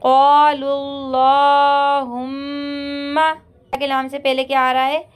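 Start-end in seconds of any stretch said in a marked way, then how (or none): 0.0-3.2 क़ालुल्लाहुम्मा with a full, heavy lam, very slow; tajweed demo, heavy lam. Shown as a demonstration. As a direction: recite क़ालुल्लाहुम्मा slowly with a thick lam. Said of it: "पूर पढ़ेंगे".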